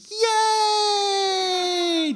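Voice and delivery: high, loud squeal of joy "Yay!"; cheering yay. Retold or imitated. Comedian imitating the slide attendant cheering riders on, high squeal. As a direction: cheer like each rider just won. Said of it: "cheering for them".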